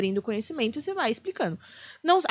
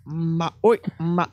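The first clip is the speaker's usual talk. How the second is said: imitating Silvio Santos; 6 semitones lower, much slower than usual.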